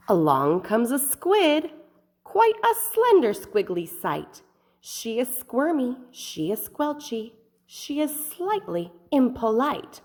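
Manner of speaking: put-on lively read-aloud for little kids; expressive picture-book reading; storybook narration. A narrator's reading. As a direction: paint the underwater scene for children. Narrating the squid.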